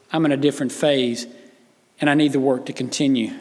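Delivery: hushed sermon. Acted. Hushed intimate preaching stretch.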